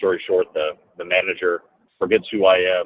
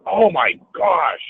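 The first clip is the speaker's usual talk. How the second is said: slow, high, stunned oh my gosh; amazed; 10 semitones higher, 3 dB louder, much slower than usual. Retold or imitated. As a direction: gasp at the money. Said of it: "and I went".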